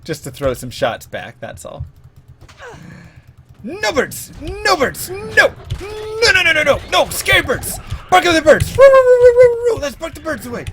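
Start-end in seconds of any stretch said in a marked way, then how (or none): 3.6-7.8 very high, loud frantic nos; panicked shout. Real, not acted. Streamer yelling at scary birds, high and frantic. 8.1-9.8 very high, loud yapping roos; mock dog bark. Streamer barking to scare the birds, high and loud.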